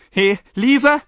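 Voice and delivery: very loud, high call to the maid. A narrator's reading. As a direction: shout for her across the inn. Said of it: "ruf ich das Mädchen". (lautes, hohes Herbeirufen)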